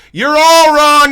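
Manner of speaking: spring the wrong answer on them loudly; game host teasing everyone who guessed wrong; gleeful gotcha. Real, not acted. Trivia host reveals the answer.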